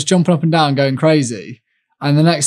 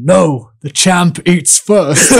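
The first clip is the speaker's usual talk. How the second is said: slow, higher proud declaration; imitating Ronaldo; 5 semitones higher, 4 dB louder, much slower than usual. Athlete quoting Ronaldo at breakfast, slow and proud. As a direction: lay down the law like a boastful champ. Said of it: "I just remember him saying".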